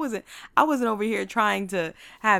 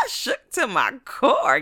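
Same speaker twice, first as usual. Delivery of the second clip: loud, drawn-out, higher exclamation; comic emphasis; 6 semitones higher, 10 dB louder, much slower than usual. Retold or imitated. Storyteller exaggerating how shaken she was, loud and drawn out. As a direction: belt it out slowly, stretching CORE.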